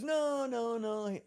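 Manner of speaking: high, easy no, no, no; mild imitation of Paul; laid-back refusal. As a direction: lightly voice Paul shrugging it off. Voicing Paul.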